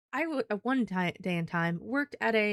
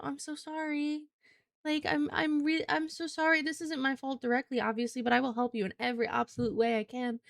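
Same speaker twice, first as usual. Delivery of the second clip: higher, apologetic service voice; sweet, sorry-sounding clerk voice; customer service voice; 3 semitones higher than usual. Shown as a demonstration. Clerk apologizing to an angry Detroit customer, higher and sweeter. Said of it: "you do the".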